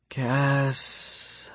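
high sighed "Cass" with a long trailing S; sighed, drawn out. A narrator's reading. Feverish patient to her carer, "Cass" sighed out with a long breathy S. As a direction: stretch the S of "Cass" until only breath is left. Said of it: "she sighs, drawing out the S in my name".

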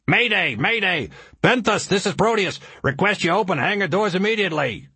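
high-pitched emergency radio plea; urgent mayday call. Acted. Pilot radioing the ship for rescue, urgent mayday. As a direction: send the distress call with pitch held up.

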